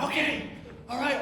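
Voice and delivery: lightly put-on high voice of agreement; acted willing reply. Acting reply to the man's call.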